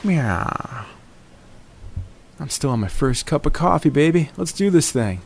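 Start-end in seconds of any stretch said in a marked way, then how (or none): 0.0-0.9 playful cat meow. Pretend. Cat character meows.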